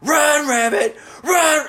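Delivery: hammed-up killer scream; screaming at camera. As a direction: yell it into the camera, building. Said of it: "him screaming at the camera".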